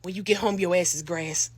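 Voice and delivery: low, soft menacing mom; a hint of a stern mother; mock stern mother. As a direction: threaten quietly like a mother. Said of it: "last thing she said".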